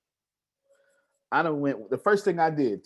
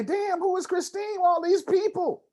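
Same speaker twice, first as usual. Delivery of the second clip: amazed; 13 semitones higher than usual. Retold or imitated. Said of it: "And they gonna say".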